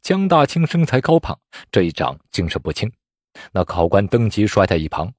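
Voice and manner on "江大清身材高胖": ordinary delivery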